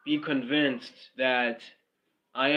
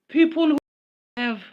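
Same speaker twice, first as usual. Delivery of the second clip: mock loud; 14 semitones higher, 7 dB louder than usual. Mocking clout chasers.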